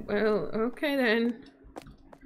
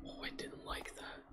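scared whisper; 23 dB quieter than usual. Real, not acted. Spooked whisper.

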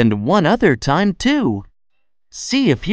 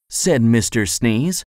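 plain reading voice

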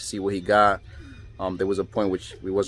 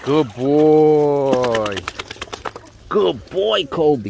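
praising dog; 4 semitones higher, 5 dB louder, much slower than usual. Real, not acted. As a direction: warm praise for a pet. Praising dog after swim.

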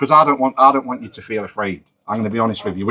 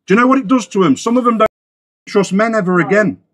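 higher, raised, furious lecture; angry telling-off; 9 semitones higher than usual. Real, not acted. Confronting the man over what it does to young people, higher angry rant. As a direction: lay into him, voice pitched up. Confronting suspect about harm to kids.

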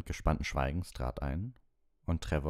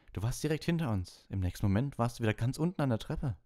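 put-on high, aggrieved boyish complaint; indignant Ron; 7 semitones higher than usual. Ron complaining to Hermine on the stairs, indignant. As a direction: vent at her for not lying.

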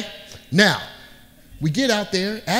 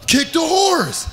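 shouted order; 9 semitones higher, slower than usual. Retold or imitated. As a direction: yell it to urge the horse on. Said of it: "I was like".

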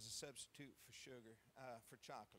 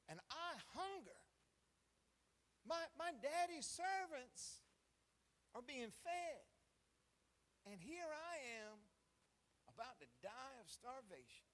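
pitched much higher and louder, pleading; emphatic cry; 8 semitones higher, 5 dB louder than usual. Acted. Prodigal son declaring his hunger, emphatic high cry. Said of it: "He's speaking that emphatically".

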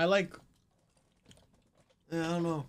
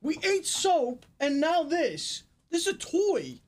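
high, slow disbelief; can't believe what they're eating; incredulous; 10 semitones higher, slower than usual. Real, not acted. Shocked at inedible box.

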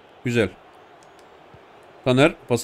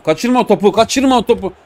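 urgent plea; 6 semitones higher, 4 dB louder than usual. Real, not acted. Pleading with striker.

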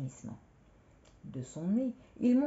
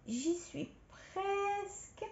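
high, drawn-out stretching voice; straining to reach; 13 semitones higher, 5 dB quieter, much slower than usual. Acted. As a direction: stretch each word as you reach up on tiptoe. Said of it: "Elle s'étira de tout son long".

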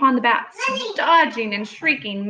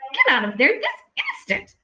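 high, alarmed scolding; scolding mother; 8 semitones higher than usual. A narrator's reading. Mother bird orders babies out of danger, alarmed scolding. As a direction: order them down right now.

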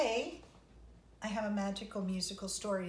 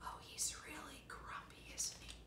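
whisper; 6 semitones higher, 11 dB quieter than usual. Real, not acted. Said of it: "aside to kids".

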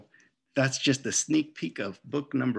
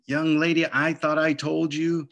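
scolding mom; 5 semitones higher, 5 dB louder than usual. Acted. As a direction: scold her like a fed-up mother.

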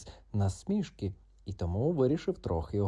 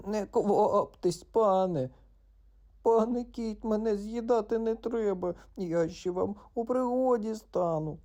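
high, slow begging frog voice; pleading frog; 11 semitones higher than usual. Acted. Frog begs the cat, high and pleading. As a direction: beg for your life. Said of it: "жалісливо просилось жабеня".

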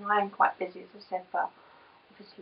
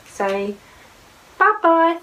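coaxing bye-bye; 7 semitones higher, 5 dB louder, slower than usual. Real, not acted. Prompting child to say goodbye.

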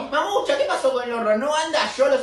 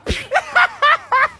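high put-on giggle; high laugh; 14 semitones higher, 4 dB louder than usual. Kid amused by the crocodile, high giggle.

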